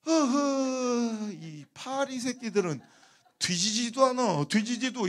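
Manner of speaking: loud, very drawn-out mock grumble; grumbling Chungcheong grandpa. Melon seller ignoring the haggler, drawling grumble. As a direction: curse the flies slowly instead of answering. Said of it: "갑자기 할아버지가".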